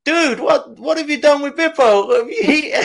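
high-pitched incredulous friend impression; imitating the friend. Teasing the friend over what happened to Bippo, high mock-incredulous imitation. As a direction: go high and incredulous, breaking towards laughter. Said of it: "I remember you were like".

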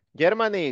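teacher models "Germany" in English; English pronunciation. Shown as a demonstration. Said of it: "Germany, pues, es Alemania".

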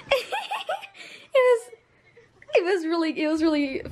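laughing through the words. Real, not acted. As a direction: break into a giggle mid-sentence. Laughing recalling fun collab.

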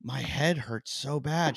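slow, lightly acted pained complaint. Wife complaining in bed about her headache, mildly pained. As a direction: draw it out, imitating someone hurting.

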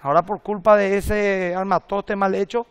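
ordinary talk

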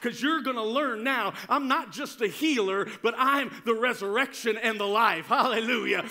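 ordinary talk, nothing marked

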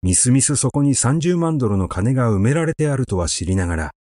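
usual speaking voice

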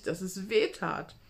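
usual speaking voice

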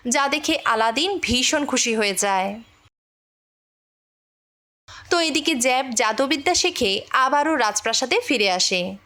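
usual speaking voice

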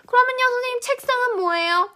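high-pitched imitated student question; pupil asking. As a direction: pipe up like a pupil questioning the teacher. Teacher voices a pupil asking a question.

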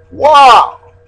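loud, high exclamation of wonder; surprised. Acted. Teacher reacting to the brothers' discovery, surprised. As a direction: burst out with an amazed wow. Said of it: "they were so surprised".

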